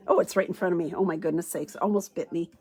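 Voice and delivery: ordinary delivery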